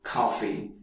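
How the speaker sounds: coffee in a soft Australian accent. Shown as a demonstration. Teacher showing Australian coffee, Australian accent. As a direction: say coffee like an Aussie. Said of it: "Australian English".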